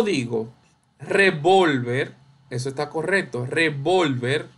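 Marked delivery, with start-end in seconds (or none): 1.0-2.1 "revólver" with VOL stressed and drawn out; re-VOL-ver, slow and emphatic; stress on middle syllable. Shown as a demonstration. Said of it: "vol es la sílaba tónica". 3.4-4.5 "revólver" slowly, weight on VOL; re-VOL-ver, middle syllable stretched; stress on middle syllable. Shown as a demonstration. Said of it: "vol es la sílaba tónica".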